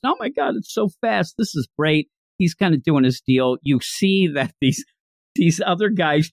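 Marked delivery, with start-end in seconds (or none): 0.0-2.1 higher-pitched, awed start easing down; amazed. Retold or imitated. Co-host voices an amazed passenger in the truck, amazed. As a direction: play mock amazement at the speed. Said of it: "she's amazed".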